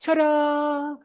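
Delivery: bright high mock fanfare, imitating her; showy ta-da. Model greeting her mirror with a high playful ta-da. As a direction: announce yourself to the mirror like a magic trick. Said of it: "looks in the mirror and goes".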